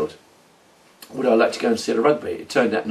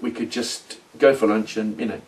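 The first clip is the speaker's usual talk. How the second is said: ordinary delivery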